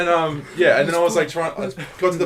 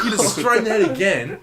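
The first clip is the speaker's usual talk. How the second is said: ordinary talk, nothing marked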